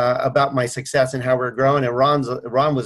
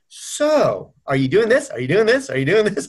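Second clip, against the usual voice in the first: pointed challenge; 6 semitones higher than usual. Retold or imitated. Mentor answering whining staff with coach's questions, pointed. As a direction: throw the questions back, playfully insistent. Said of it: "we throw right back at them".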